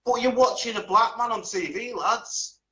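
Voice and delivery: high-pitched, needling jibe; retold taunt across a bar; teasing call-out. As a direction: toss a mock-innocent curveball at the lads. Retells teasing lads at bar.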